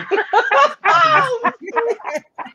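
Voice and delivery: high, loud burst of laughter; real shriek of laughing; howling laugh at her own joke. Real, not acted. Cracking up at ugly dog joke.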